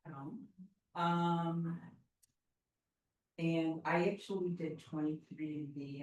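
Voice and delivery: normal talking voice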